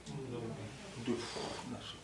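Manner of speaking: normal talking voice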